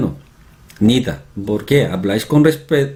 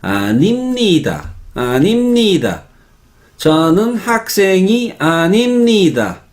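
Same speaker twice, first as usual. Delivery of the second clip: put-on Spanish accent; 7 semitones higher than usual. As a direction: imitate Spaniards drawing out each Korean syllable. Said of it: "No se pronuncia así". (acento español imitado, lento, sílaba por sílaba)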